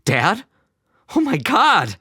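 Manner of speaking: high-pitched startled shout. A narrator's reading. Son startled that his father answers, shouting high. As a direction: cry out in shock, pitch leaping. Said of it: "Albert shouted".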